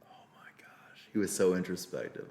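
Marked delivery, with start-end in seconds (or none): 0.0-1.0 awed whisper. Real, not acted. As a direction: breathe it out, stunned. Moved by the story.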